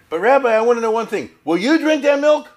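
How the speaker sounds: high, loud, pressing challenge; challenging question. Retold or imitated. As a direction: corner the rabbi with it, loud and high. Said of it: "and he said".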